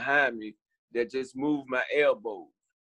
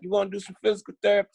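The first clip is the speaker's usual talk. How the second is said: quick, higher-pitched nurse's offer; lightly voiced nurse; 7 semitones higher, 3 dB louder, much faster than usual. Nurse asking a patient about therapy, quick and higher. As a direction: rattle it off as a brisk question. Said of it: "that's how she talked".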